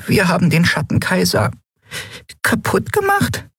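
a little fairy suddenly frightened by what they did; high fairy voice breaking into a squeal; tiny fairy voice, alarmed. Acted. Fairy Nelen speaks.

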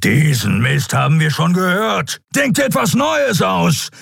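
high, strained roaring outburst; lion-like roar. A narrator's reading. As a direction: roar it out, stretching the last words. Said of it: "stieß ein löwenhaftes Brüllen aus". (löwenhaft gebrüllt, ohne volle Lautstärke)

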